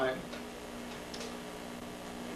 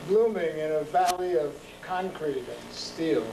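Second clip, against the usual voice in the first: slow, measured poem reading with long pauses; poetry reading; 2 semitones higher than usual. Acted. Poet reading his street poem to a live audience. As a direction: let each image land, then pause.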